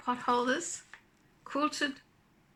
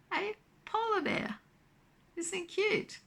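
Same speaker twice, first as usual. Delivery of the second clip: excited; 10 semitones higher than usual. Real, not acted. Delighted reveal of find.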